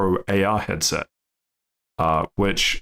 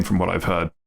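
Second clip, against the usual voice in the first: ordinary talk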